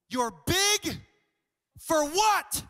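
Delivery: loud, high demand with a break after big; incredulous challenge. Acted. Acts out challenging big guys.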